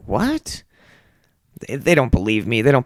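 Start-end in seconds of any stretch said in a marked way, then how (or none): 0.1-0.6 disbelieving what. Retold or imitated. Coworkers' disbelief, retold.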